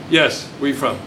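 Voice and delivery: ordinary delivery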